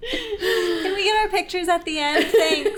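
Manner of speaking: high and loud through giggles; giggling the request; laughing. Retold or imitated. Retelling while cracking up.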